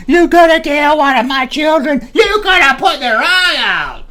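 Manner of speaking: mock screaming neighbor; screaming neighbor lady. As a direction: shriek it like the furious neighbor lady. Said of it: "she came out screaming".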